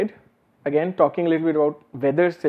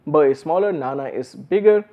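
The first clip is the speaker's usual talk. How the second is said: ordinary delivery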